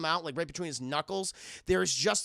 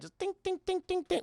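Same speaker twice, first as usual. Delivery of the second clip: ding sound effect; 12 semitones higher than usual. Retold or imitated. Bullets dropping in sink.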